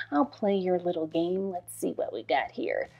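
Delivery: low, soft, wry mutter; sceptical. Retold or imitated. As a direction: humour him under your breath. Mock sceptical reply to husband.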